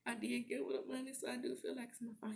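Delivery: high, soft mock crying voice; imitated tearful confession. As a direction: quietly own up, as if close to tears. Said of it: "He's crying".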